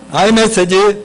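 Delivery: normal talking voice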